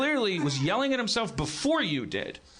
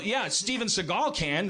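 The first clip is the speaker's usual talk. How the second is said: usual speaking voice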